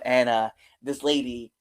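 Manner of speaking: ordinary talk, nothing marked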